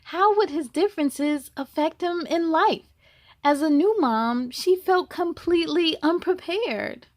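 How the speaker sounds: put-on reading to children. A narrator's reading. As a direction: tell it brightly to the little ones. Reads the picture book aloud.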